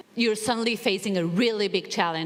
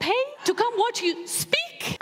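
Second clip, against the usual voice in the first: put-on doubtful old man; 7 semitones higher than usual. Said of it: "he looks at me and goes".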